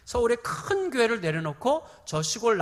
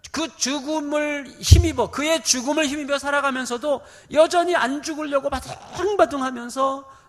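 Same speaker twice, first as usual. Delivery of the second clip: higher and louder, pressing on; fiery preaching; 7 semitones higher, 7 dB louder than usual. Acted. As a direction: preach it at full force. Preaching climax.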